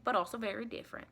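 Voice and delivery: ordinary talk, nothing marked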